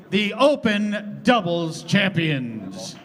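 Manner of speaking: normal talking voice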